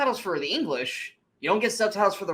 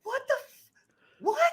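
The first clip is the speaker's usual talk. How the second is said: very high-pitched, baffled what; stunned disbelief. Retold or imitated. Viewer reacting to an absurd movie scream, stunned disbelief. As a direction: squeak it in replayed disbelief.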